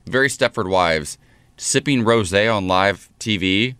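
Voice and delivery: ordinary talk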